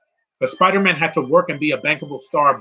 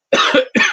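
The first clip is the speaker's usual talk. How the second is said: sudden dry cough; coughing on dust; 12 semitones higher, 6 dB louder than usual. Real, not acted. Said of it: "excuse me ... dust in my throat".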